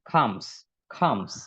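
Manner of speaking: "খামস" said with an aspirated kh, low and clipped; comes with kh sound. Shown as a demonstration. Teacher corrects "comes" to an aspirated kh sound. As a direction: say "খামস" with a breathy kh at the start. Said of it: "এটা হচ্ছে খর উচ্চারণ হবে".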